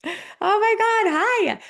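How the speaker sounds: high, slow, squealing oh my God; stretched-out high gush of welcome; gushing excited greeting. Real, not acted. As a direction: greet a newcomer with delighted surprise. Greets a newcomer in chat.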